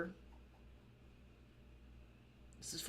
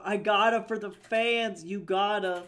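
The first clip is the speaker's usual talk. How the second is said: loud excited call; loud hype for the fans; 5 semitones higher, 10 dB louder than usual. Real, not acted. Loud exclamations to chat.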